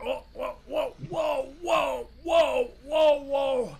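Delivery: high, loud cries of alarm; string of high, urgent warning shouts; alarmed whoas. Real, not acted. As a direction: yell for the driver to stop right now. Truck nearly goes too far.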